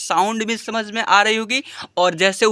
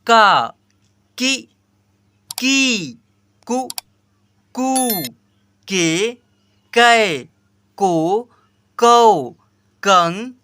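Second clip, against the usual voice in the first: loud sing-song chant of each syllable; syllable recitation; 3 dB louder, much slower than usual. Shown as a demonstration. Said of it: "मैं जैसे repeat कराता हूँ". (ज़ोर से लय में अक्षर पढ़ना)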